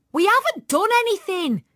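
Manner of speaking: high, loud indignant yell; loud protest from an accused girl. A narrator's reading. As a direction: yell your innocence up high. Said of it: "Tanya yelled".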